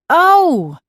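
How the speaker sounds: high, loud surprised gasp of a word; bright sudden surprise. A narrator's reading. Surprised at meeting Mystic May.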